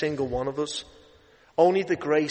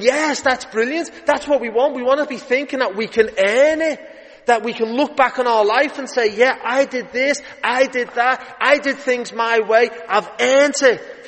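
higher, louder, smug inner voice; mock self-satisfied; 8 semitones higher, 7 dB louder than usual. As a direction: crow over your own achievements, hammed up. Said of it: "I'm thinking".

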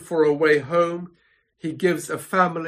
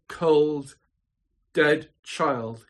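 ordinary talk

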